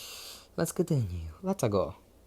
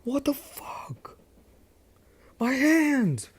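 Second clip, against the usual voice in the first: high strained pain cry; bully whining in pain, pitched way up; mock pained scream; 14 semitones higher, much slower than usual. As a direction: squeal about his hands, hammed up. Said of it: "Monoma screaming in pain".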